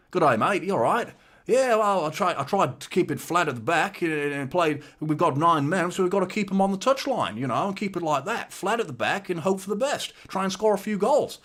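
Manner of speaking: high, lively mock-Aussie voice; Australian accent. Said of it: "it's the Australian accent".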